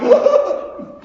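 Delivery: high, breathy mocking laugh. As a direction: laugh at him in disbelief. Onlookers react to Peter.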